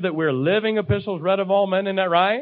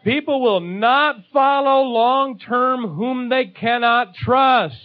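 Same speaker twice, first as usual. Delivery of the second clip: slow emphatic; 5 semitones higher, much slower than usual. Real, not acted. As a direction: drive the point home word by word. Preacher's climactic line.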